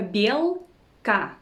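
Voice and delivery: belka slowly, bel, then ka; syllable by syllable. Shown as a demonstration. Teacher teaching the word for squirrel, syllable by syllable. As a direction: split belka into two separate syllables.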